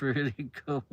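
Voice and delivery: ordinary talk, nothing marked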